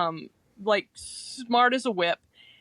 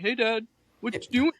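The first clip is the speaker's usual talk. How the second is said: lightly put-on eager dog voice; a hint of a pestering pup; dog's voice; faster than usual. Hanzo pestering his dad, lightly voiced eager dog. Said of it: "share what Hanzo's voice is".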